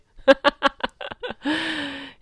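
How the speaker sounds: bright high laughing burst. Real, not acted. Host laughing after doing a country accent. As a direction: laugh at your own put-on accent. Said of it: "laughing at own accent".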